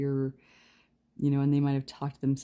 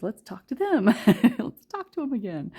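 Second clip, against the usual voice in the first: high, loud, laughing mock excitement; excited spirit guides; 9 semitones higher, 9 dB louder than usual. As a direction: pipe up eagerly as the guides, breaking into laughter. Voicing playful spirit guides, laughing.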